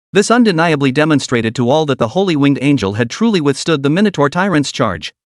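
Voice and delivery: ordinary delivery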